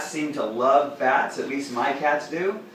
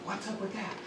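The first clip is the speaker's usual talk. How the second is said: voicing the cat; 7 semitones higher, 12 dB quieter, faster than usual. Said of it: "Light is like".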